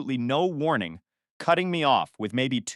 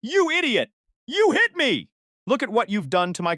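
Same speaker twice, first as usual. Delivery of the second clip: high-pitched outraged yell, easing on the last sentence; screaming; 10 semitones higher, 3 dB louder than usual. A narrator's reading. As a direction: scream the accusation, then let it drop. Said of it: "He started screaming".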